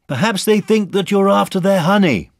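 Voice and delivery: high, loud call. A narrator's reading. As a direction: shout a suggestion overhead. Boy calls up to Pooh.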